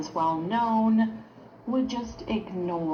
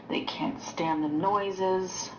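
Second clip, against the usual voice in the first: usual speaking voice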